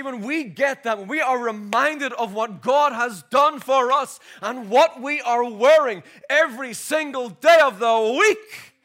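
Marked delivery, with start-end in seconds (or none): 2.3-8.7 louder and slower, pressing each word; fervent preaching. Real, not acted. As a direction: lean in and preach it hard. Preaching build.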